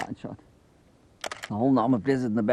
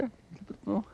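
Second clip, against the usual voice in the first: much higher, softer coax to the animal; 9 semitones higher, 8 dB quieter than usual. Real, not acted. Coaxing animal to drink.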